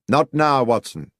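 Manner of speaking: curt refusal. A narrator's reading. Holmes curtly refuses through door.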